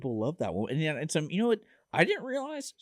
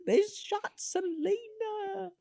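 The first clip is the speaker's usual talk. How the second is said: high, very slow, held-back mock scream; small drawn-out imitation of a scream; mock scream, softened; 4 dB quieter, much slower than usual. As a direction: wail it softly, stretching every word. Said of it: "comes into the gym and screams".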